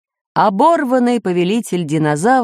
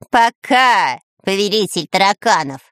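mild mocking call; taunting shout; 3 semitones higher than usual. A narrator's reading. Spy taunts the ruler left behind, mocking shout. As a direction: call a jeering goodbye after him. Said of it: "крикнул ему вслед Дырка".

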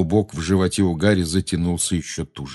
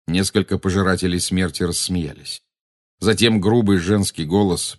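plain reading voice

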